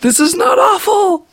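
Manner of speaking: high squealing disbelief, half laughing; stunned, laughing yelp of surprise; shocked delight. Retold or imitated. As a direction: squeal it like you can't believe it's good. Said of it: "started to read it and was like".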